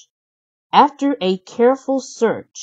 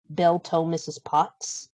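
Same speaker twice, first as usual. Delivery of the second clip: usual speaking voice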